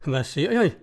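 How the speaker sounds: mildly astonished narrated question; exclaiming. A narrator's reading. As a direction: ask it as if dumbfounded. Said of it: "råbte han". (let forbløffet udbrud)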